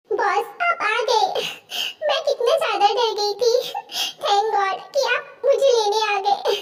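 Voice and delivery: acted sobbing between phrases; tearful relief. Stranded woman to the boss who came for her, crying with relief. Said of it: "हग करके रोने लगती है".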